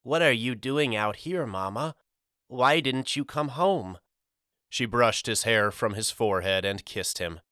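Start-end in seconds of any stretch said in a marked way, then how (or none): 0.0-4.0 lightly voiced little boy, a touch higher; a child gently pleading with his long-lost mother; small boy asking. A narrator's reading. Boy Pip asking Mama why she never came home, lightly childlike. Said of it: "Pip hugged her and asked".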